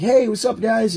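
high-pitched hail to a group; eager greeting. Acted. Newcomer walks up on guys hanging out, eager greeting.

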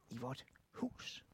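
breathy hushed "i vort hus"; whispered close to the children. Shown as a demonstration. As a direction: drop to a secret whisper. Trailing into a whisper.

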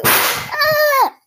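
sudden high startled cry; startled yelp. Real, not acted. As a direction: yelp in surprise. Hears a giant bang, startled.

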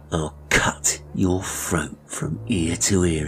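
slow, fully voiced villain's menace; menacing threat. Barber warning the boy to keep silent, menacing. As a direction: spell out the threat deliberately.

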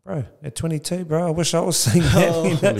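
high, loud, excited retort to his son; half laughing, half amazed at a young man's self-awareness; amused impressed dad. Retold or imitated.

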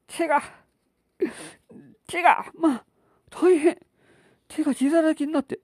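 high, frantic panicking wife; panicked wife. Acted. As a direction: shriek in panic at the blood. Wife panics at bleeding hand.

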